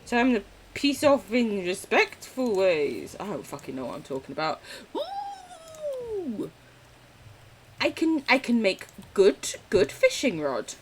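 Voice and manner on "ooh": excited high ooh. Real, not acted. Excited noise in game.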